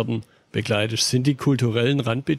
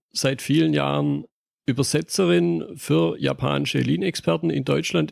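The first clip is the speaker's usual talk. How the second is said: ordinary talk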